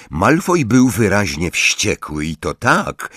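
ordinary delivery

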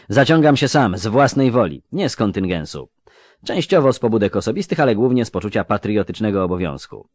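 put-on earnest, proud young clerk; young scribe voice. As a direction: declare it plainly and proudly. Jare declares.

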